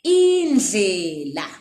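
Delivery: Indlela slowly, with a lateral dl; slow teaching pronunciation; careful Ndebele word. Shown as a demonstration. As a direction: stretch Indlela for the learner. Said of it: "next word".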